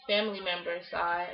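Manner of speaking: normal talking voice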